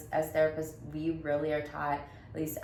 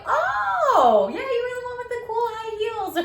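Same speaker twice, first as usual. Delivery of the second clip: much higher, loud, laughing impression of the interviewer; mock interviewer; 10 dB louder than usual. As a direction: squeal it like he suddenly remembers. Mimicking interviewer recognizing her.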